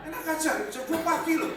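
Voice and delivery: high, loud "no way"; indignant shout. Real, not acted. Pastor mocks the late-night caller to the congregation, loud and indignant. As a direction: scoff it out loudly.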